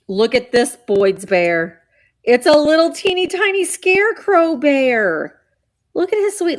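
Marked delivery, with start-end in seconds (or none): 2.2-6.6 gushing. Real, not acted. Gushing over a bear.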